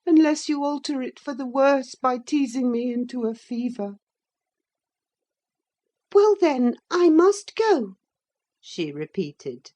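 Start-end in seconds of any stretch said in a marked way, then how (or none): 0.1-4.0 put-on high, peevish boy's grumble; pettish. A narrator's reading. Linton blames his cousin for teasing him, high pettish voice. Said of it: "he replied pettishly". 6.1-7.9 put-on high, loud girlish resolve; reluctant young lady. Catherine repeats she must leave Linton, high reluctant voice.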